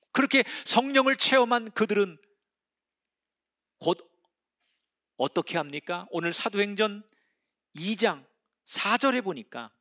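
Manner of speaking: ordinary talk, nothing marked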